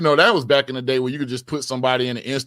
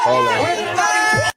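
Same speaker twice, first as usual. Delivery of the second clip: sudden high call; 3 dB louder than usual. Real, not acted. Sudden interruption.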